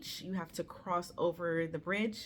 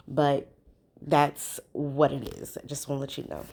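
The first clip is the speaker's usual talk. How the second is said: earnest aside; 2 semitones lower, 7 dB louder than usual. Real, not acted. Sincere aside to viewers.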